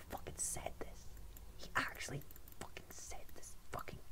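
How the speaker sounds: hushed, voiceless mock outrage; outraged whisper. YouTuber outraged at the pasta hater, whispering. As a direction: whisper it in scandalised disbelief.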